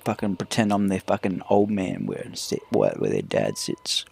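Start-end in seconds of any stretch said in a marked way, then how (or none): 2.0-4.0 much lower, slow dad voice; mock old man. Pretending to be the kids' old man, lowered dad voice. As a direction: drop deep like a gruff old father.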